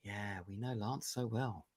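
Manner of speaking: slow, knowing whisper from the stream's viewers; mock whisper. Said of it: "my friends ... will be going".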